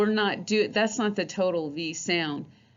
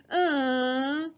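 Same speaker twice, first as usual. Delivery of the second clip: higher whiny uh; 7 semitones higher than usual. Shown as a demonstration. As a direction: whine it out. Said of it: "like when somebody's whining".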